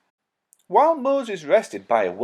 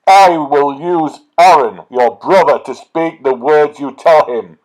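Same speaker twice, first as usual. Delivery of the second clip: angry God; 13 dB louder than usual. Acted. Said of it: "God became angry".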